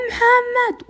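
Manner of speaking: high, loud desperate scream; loud screamed name. Retold or imitated. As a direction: yell his name with all her strength. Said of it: "وأصرخ بأقوى قواتي".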